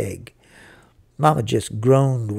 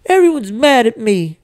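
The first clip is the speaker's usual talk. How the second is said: put-on high, loud aggrieved boy; hurt, tearful wail; 8 semitones higher, 5 dB louder than usual. A narrator's reading. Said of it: "really did get tuned up".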